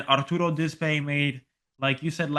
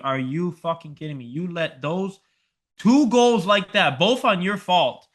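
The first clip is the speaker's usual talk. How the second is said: replaying his fury at the defender, getting louder; mock furious shout at a player; retold angry yell; 4 semitones higher, 6 dB louder than usual. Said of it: "I was screaming".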